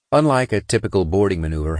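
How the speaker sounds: usual speaking voice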